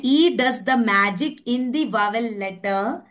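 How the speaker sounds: put-on slow, clear storytelling for kids; slow, deliberate classroom delivery; slow teaching voice. Shown as a demonstration. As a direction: say it slowly as if telling children a tale. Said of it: "ऐसे बच्चों को सिखाना है".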